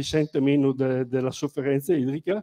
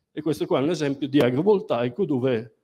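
ordinary delivery

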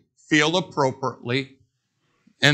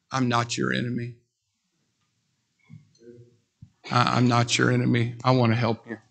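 low, soft, earnest plea; gently reach out to them; sincere plea; 7 semitones lower, 3 dB quieter than usual. Real, not acted. Pastor assuring his listeners, low and sincere. Closing plea after service.

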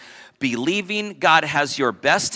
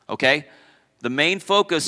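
normal talking voice

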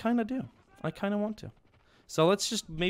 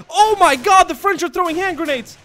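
very high, loud cry of dismay; panicked gamer outburst; alarmed yelling; 13 dB louder than usual. Real, not acted. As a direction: shout in horror at incoming grenades. Reacting to grenades hitting squad.

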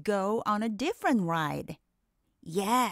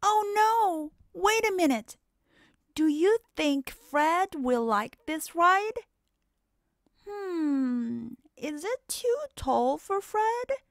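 mock worry; 8 semitones higher than usual. Worry about little Fred.

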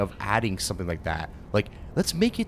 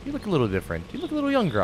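teasing; 8 semitones higher, much faster than usual. Real, not acted. Teasing a game character.

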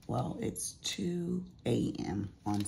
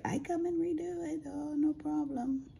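high, breezy contractor imitation; mock his easy promise in a high voice; mimicking contractor. Homeowner mocking the contractor's breezy promise, high voice. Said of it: "And he was like".

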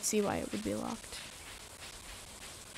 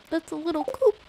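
higher, cracking on "coop"; 6 semitones higher, 3 dB louder than usual. Real, not acted. Repeating the coop remark, voice cracking. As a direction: break upward on the final word. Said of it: "let my voice crack a little more".

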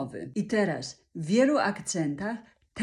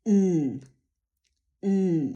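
humming m; 2 semitones lower than usual. Shown as a demonstration. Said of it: "I znowuż dźwięk nosowy".

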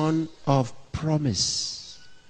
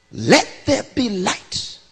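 higher, slow, commanding proclamation; voice of God; 10 semitones higher, 4 dB louder than usual. Acted. Preacher voicing God's first command to the dark world, high and slow. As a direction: speak it as God calling light into being. God speaks creation into being.

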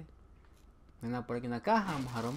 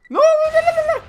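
very high, very loud scream; panicked scream; 16 dB louder than usual. Real, not acted. Player startled by the game, screaming. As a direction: scream a long run of no's at the top of your voice. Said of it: "Me haces gritar".

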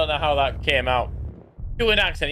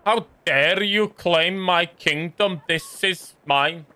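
slow, haughty put-on accent; mock Uhtred accent; slower than usual. Streamer imitating Uhtred's strange accent, slow and haughty. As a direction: play a warlord claiming his throne. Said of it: "he comes with the accent like this".

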